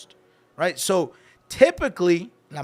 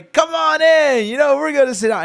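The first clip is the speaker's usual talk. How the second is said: calling out; 9 semitones higher, 8 dB louder than usual. Retold or imitated. Customer calling his big party in to sit down, calling out. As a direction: holler it to round everybody up.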